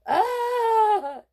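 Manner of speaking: loud, high scream of fright; scared yell. Acted. Boy screaming at the bear behind him. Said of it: "yelled Donovan".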